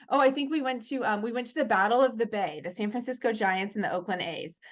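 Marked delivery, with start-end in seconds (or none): none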